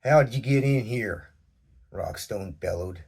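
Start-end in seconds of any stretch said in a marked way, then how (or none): 0.1-1.3 loud, bellowing demand; loud and deep. A narrator's reading. Sheriff bellowing at the stranger, loud and demanding. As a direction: bellow it at the intruder. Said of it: "his voice loud and deep".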